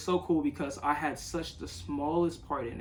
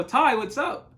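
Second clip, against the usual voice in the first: higher, louder, startled greeting; a startled, raised hello to someone unexpected; surprised; 9 semitones higher, 7 dB louder, slower than usual. Retold or imitated. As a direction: call out in surprise at finding him there. Surprised Ty already on porch.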